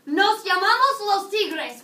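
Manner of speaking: loud, high, drawn-out mock cheer; excited team shout. Students announcing their team name to teacher, excited shout. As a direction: call out to teacher like an eager team. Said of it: "And you can tell me".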